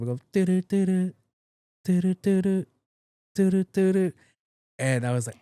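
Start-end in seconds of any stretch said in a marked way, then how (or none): 0.3-4.1 high, even electronic beeps; high-pitched alarm beeping done by mouth; alarm clock imitation. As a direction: mimic a repeating alarm in short identical bursts. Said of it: "normally my alarm goes up".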